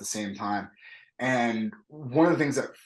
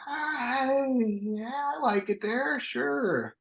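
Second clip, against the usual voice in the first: sleepy wife voice; 14 semitones higher, much slower than usual. Retold or imitated. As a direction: play the half-awake wife, pitched way up and hushed. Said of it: "She's like".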